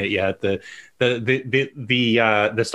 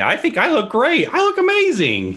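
much higher, boastful voice; mock vain; 10 semitones higher than usual. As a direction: preen like a vain star.

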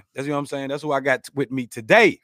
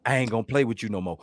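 imitating big brother; 5 semitones lower, 5 dB quieter, faster than usual. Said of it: "he would say this to me".

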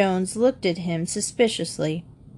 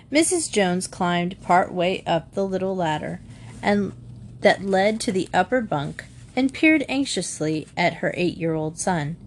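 ordinary delivery, nothing marked

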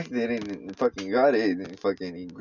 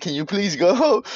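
higher, slow, drawn-out pleading; retold exasperated plea; 7 semitones higher, 3 dB louder than usual. Telling the dude over here, exasperated plea. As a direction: plead with a bystander to leave, mock exasperated.

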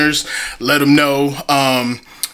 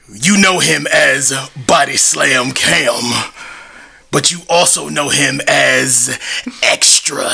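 ring announcer. Acted. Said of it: "fire introduction".

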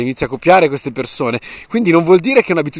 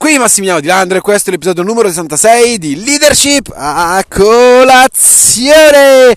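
podcast host intro; 8 semitones higher, 5 dB louder than usual. Acted. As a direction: welcome listeners like a show host.

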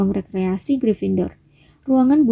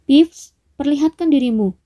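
higher, firm call-out; raised voice; 5 semitones higher than usual. A narrator's reading. Percy calling the mischievous ghost, voice raised. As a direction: call the hidden one out, voice lifted. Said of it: "Dia mengeraskan suaranya".

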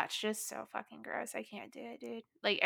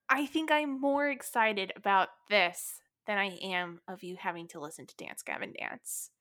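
normal talking voice

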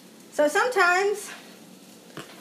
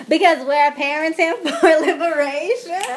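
laughing; 5 semitones higher, 6 dB louder than usual. Real, not acted. Laughs over the flubbed title.

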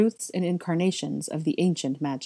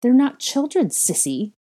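high, loud, vicious retort; heated and scornful, first words punched high; hissed; 8 semitones higher, 7 dB louder than usual. A narrator's reading. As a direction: contradict your sister with venom. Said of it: "Bellatrix hissed".

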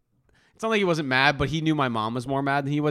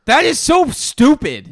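slow, loud, raised-pitch protest; real exasperation; 10 semitones higher, 8 dB louder, slower than usual. Real, not acted. Friend teased about how he walks, exasperated. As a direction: drag it out, loudly indignant. Bickering over a teasing claim.

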